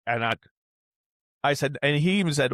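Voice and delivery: ordinary talk, nothing marked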